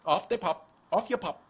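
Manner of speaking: best attempt at a British accent; amateur British send-off. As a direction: put on a jokey British accent. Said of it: "That's my best British accent".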